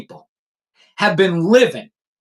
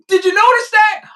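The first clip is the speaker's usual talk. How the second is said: loud, high, pointed question; high mocking question; 11 semitones higher, 3 dB louder than usual. Real, not acted. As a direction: needle them with a high question. Repeated high taunting question.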